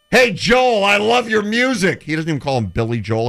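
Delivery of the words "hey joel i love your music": high, loud gushing Lasorda greeting; a touch of mock Lasorda, gushing to the singer; hammed-up Lasorda. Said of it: "he says to [name]".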